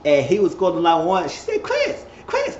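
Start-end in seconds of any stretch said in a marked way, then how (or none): 1.6-2.6 tiny, very high voice; squeaky repeated name; mock pleading call. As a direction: imitate a woman nagging for attention. Acts Monique repeatedly calling Chris.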